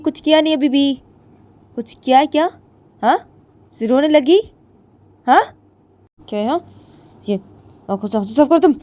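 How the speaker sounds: high, loud bullying voice; harsh scolding. A narrator's reading. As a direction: loom over her, demanding answers. Jiya scolds crying Ritu. (ऊँची, तेज़ आवाज़ में धमकाती हुई)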